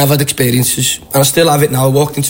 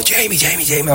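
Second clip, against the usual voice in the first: raised pitch, kept soft; imitated eager fan; 2 semitones higher, 5 dB quieter than usual. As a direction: mimic an excited fan calling out, at half volume. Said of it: "Liverpool fan comes running over".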